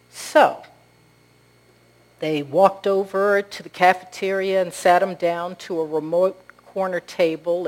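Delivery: ordinary talk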